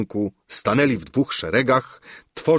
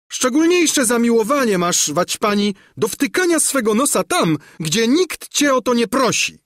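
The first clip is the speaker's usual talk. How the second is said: much higher, louder sneering dowager; scornful old countess; 14 semitones higher, 4 dB louder than usual. A narrator's reading. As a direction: scold the fairy from on high. Narrator voices the countess. (wyniosła, szydercza hrabina, wysoko i głośno)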